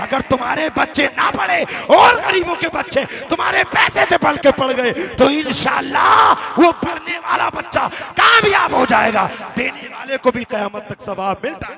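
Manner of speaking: loud, high, rousing sermon shout; impassioned shouted exhortation; full-force preaching. Real, not acted. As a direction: preach it to the back of the crowd.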